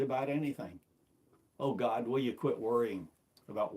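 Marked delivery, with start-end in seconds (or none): none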